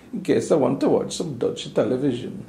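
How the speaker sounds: hammed-up, clumsy Dutch accent; jokey attempt at a Dutch accent; bad Dutch accent. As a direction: put on a terrible Dutch accent. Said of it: "That was the worst Dutch accent".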